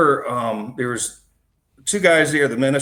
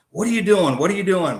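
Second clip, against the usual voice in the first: aggressive; 7 semitones higher, 4 dB louder than usual. Retold or imitated. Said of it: "He's really being aggressive".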